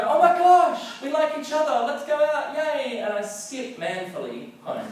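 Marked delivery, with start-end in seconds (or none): 0.1-3.0 very high, loud giddy squeal. Retold or imitated. Two teens discovering they like each other, squealing high.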